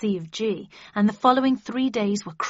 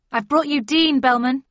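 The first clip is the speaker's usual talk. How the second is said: loud, raised announcement; higher, louder proclamation; announcing; 6 semitones higher, 8 dB louder than usual. A narrator's reading. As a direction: call it out to the whole room. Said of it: "I announced".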